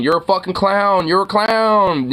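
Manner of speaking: imagined name-calling at a heckler; suggested taunt. Pretend. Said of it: "just, like, saying".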